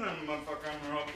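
low, soft droning robot; a hint of a sci-fi robot. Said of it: "that one off Star Wars".

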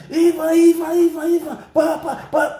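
high crying shout of a name; retold frantic shout. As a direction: wail the name, high and desperate. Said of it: "na Kevin shout".

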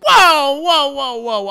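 loud, high burst of whoas, easing off; retold startled protest; shocked reaction. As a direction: blurt it in alarm, then settle. Acting own shocked reaction.

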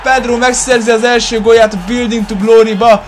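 high, loud shouting commentator; excited Hungarian sports commentary. Acted. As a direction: call the near-miss like a live commentator. Second goal in match commentary. (izgatott sportkommentár)

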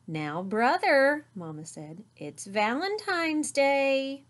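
slow, soothing motherly correction; gentle reproach. A narrator's reading. Mama calming Brother on Valentine's Day, slow gentle reproach.

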